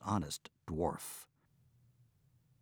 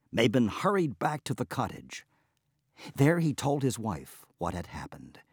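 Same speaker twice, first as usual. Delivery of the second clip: ordinary delivery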